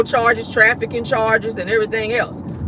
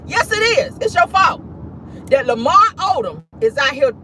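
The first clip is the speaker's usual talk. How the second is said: high-pitched, insistent blame; comic accusing squeal; mock scolding; 6 semitones higher than usual.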